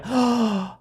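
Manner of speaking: a high, sudden gasp of discovery; a startled intake of breath, pitched high; sharp gasp. Retold or imitated. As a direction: gasp as if you just spotted it. Sees the winning tactic.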